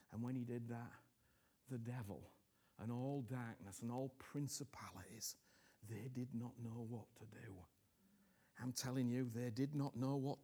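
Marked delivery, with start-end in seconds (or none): 0.1-7.5 hushed unvoiced preaching; breathy hushed sermon whisper. Real, not acted. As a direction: drop to a whisper.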